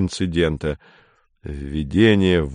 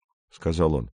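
usual speaking voice

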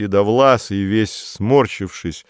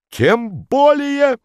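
high, slow indignant exclamation; outraged officialdom; indignant bureaucrat; 5 dB louder, much slower than usual. A narrator's reading. Modest indignant.

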